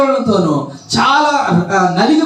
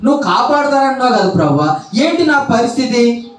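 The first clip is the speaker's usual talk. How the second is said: complaining believer; 6 semitones higher than usual. Acted. Believer questioning God's promise.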